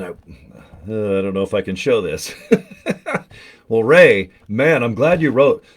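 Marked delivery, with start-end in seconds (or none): 2.4-3.2 laugh. Real, not acted. Can't show the gift.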